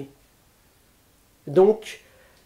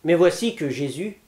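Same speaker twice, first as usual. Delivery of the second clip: ordinary delivery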